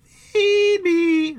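very high, loud drawn-out squeal; piercingly high stomach voice; weird gullet noise. Acted. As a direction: squeal it like a demanding creature. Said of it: "it's in these weird noises and these weird expressions".

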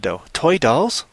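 usual speaking voice

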